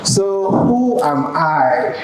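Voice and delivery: ordinary talk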